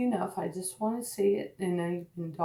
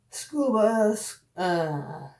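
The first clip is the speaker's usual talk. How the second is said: usual speaking voice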